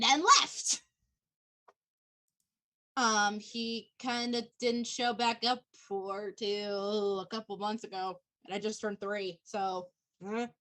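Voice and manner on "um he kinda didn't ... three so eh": low, quiet, slow and halting; subdued, dropping lower and softer; downbeat admission. Acted. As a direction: admit it reluctantly, trailing into a shrug. Admits being abandoned by sire.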